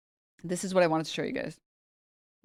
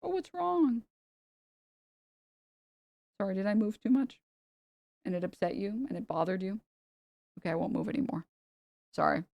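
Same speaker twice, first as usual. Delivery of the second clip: soothing the cat; 6 dB quieter than usual. Real, not acted. Talking softly to the cat.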